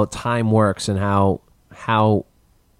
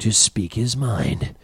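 movie trailer voice; much slower than usual. Pretend. Trailer voice bit.

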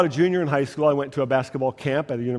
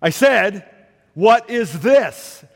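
raised, higher and louder irritated coach; 5 semitones higher, 6 dB louder, much slower than usual. Retold or imitated. Coach to silent young athletes, irritated. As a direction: repeat the question with slow, testy emphasis. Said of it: "kinda got irritated".

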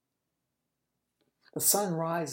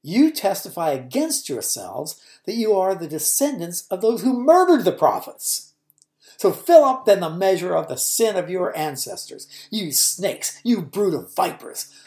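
loud, forceful preaching as Jesus; Jesus condemning the Pharisees at full force; Jesus denouncing the leaders; 3 semitones higher, 10 dB louder than usual. Acted. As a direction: thunder the rebuke at the religious leaders.

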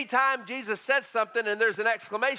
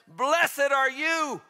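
joyful blessing; 6 semitones higher, 4 dB louder, much slower than usual. Acted. Jesus blesses Peter, voiced.

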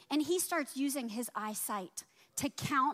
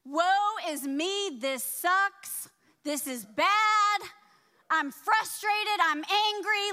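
drawn-out self-pitying whine, higher; mock whining; 5 semitones higher, 6 dB louder, slower than usual. Preacher mimics her own past pity party, mock whine. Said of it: "throwing a pity party for myself".

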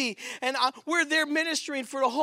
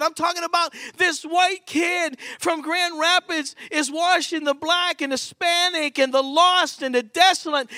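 loud, higher preaching cry; preaching shout; 5 semitones higher, 4 dB louder than usual. Acted. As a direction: shout it to the congregation. Preacher erupts over the kid.

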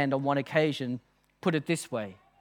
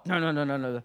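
low run of mock refusals; dismissive refusal; 4 semitones lower, faster than usual. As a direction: imitate a lecturer waving off a wrong answer. Lecturer rejecting each student answer.

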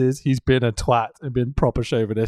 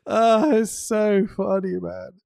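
laughing; 7 semitones higher, slower than usual. Real, not acted. Laughing at Taz line.

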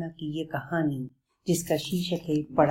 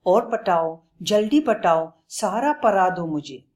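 louder, pushy lisping plea; hungry for more lessons; eager; 3 semitones higher, 5 dB louder than usual. Acted. Lion urges Bablu to teach the whole book.

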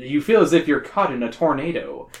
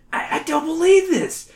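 strangled gasp; 11 semitones higher than usual. Acted. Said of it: "you gasp in a strangled voice".

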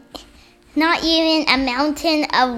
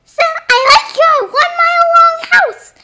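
high toy voice; 9 dB louder than usual. Pretend. Playing a toy figure's talk.

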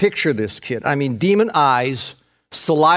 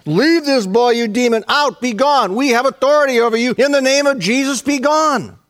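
high, forceful commands; preacher's high-pitched mock exorcism; acted exorcism; 8 semitones higher than usual. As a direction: order the demon out with authority. Acting disciples commanding a demon out.

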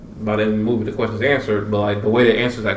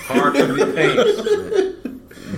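high, slow laughing squeal; much slower than usual. Real, not acted. Joking about Will and [name].

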